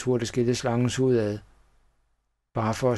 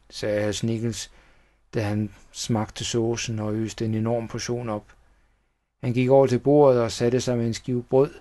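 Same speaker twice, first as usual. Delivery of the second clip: plain reading voice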